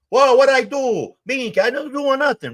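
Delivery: high, startled whine of innocence; protesting innocence. Retold or imitated. Charlie protesting innocence, voiced high.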